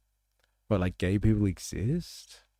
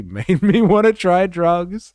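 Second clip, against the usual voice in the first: laughing; 10 semitones higher, 12 dB louder than usual. Real, not acted. Laughing at the drug program.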